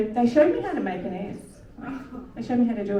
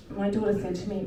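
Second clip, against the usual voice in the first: ordinary talk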